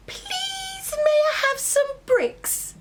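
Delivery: squeaky very high little pig begging; a tiny pig asking sweetly. Acted. Third little pig asking the brick man for bricks.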